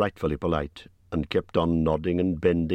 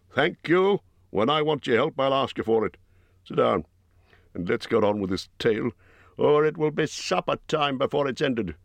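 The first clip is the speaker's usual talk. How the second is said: curt Bjorn cutting in; 7 semitones higher than usual. A narrator's reading. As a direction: cut them off as Bjorn. Bjorn voiced by narrator.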